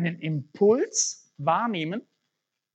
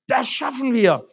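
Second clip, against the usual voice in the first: firm, louder, steady answer; confident reassurance; 8 semitones higher, 7 dB louder than usual. Acted. Helper answers the exhausted man, firm confident reassurance. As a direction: reassure him they will manage.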